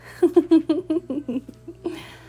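high playful giggle; flirty giggle. Acted. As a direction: giggle teasingly. Giggle during striptease.

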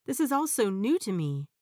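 ordinary delivery

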